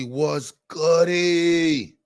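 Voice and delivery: high, very slow stretched-out greeting; drawn-out greeting. Acted. Streamer greeting Corey in a high, slow drawn-out call. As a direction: stretch every syllable like calling to a friend.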